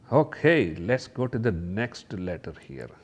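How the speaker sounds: ordinary talk, nothing marked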